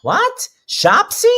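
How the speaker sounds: high, loud disbelief; incredulous. Real, not acted. Host hearing guest's Hebrew name, astonished and shrieky-high. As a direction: shoot up in pitch as a stunned question.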